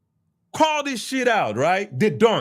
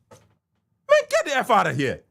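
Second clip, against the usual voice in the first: exasperated shout. Real, not acted. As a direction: blurt it out, fed up. Exasperated outburst at critics.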